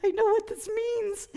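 very high comic wail of dread; mock horrified wail. Teller dreading the bathroom task, mock horrified wail. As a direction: squeal it in exaggerated dismay. Her horrified reaction, retold.

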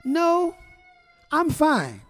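high, sweet mock wife voice; imitating her sweet brush-off; sweet and pleasant. Husband imitating wife's sweet polite refusal. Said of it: "sweet and pleasant".

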